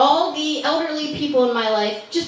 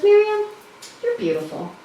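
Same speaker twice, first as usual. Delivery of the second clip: lightly voiced great aunt; 9 semitones higher than usual. Great aunt complimenting her great-niece at Thanksgiving, lightly voiced great aunt.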